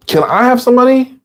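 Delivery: high, whiny begging voice; pleading asker. Acted. Teacher voicing an addict asking a friend for money, high and whiny.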